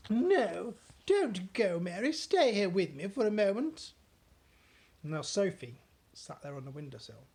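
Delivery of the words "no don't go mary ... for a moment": louder, urgent appeal; pleading Queen. Acted. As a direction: keep her from leaving.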